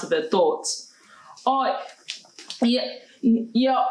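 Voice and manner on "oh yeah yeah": halting, slightly croaky reply; croaked. A narrator's reading. Layla answering the teacher, a mild croak. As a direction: stammer it out with a catch in the throat. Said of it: "Layla croaked".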